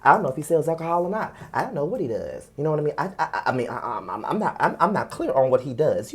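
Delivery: low, soft mock-clueless denial; feigned ignorance, pitched low and quiet; playing dumb. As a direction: act like you barely know him. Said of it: "Stop trying to play dumb and stupid".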